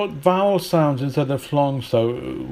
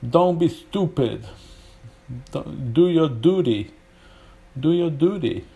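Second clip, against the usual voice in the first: higher, hammed-up American, "duty" as DOO-dee; American accent; 3 semitones higher than usual. Shown as a demonstration. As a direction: put on a mock American accent. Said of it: "sound like Sylvester Stallone".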